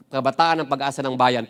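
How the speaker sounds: normal talking voice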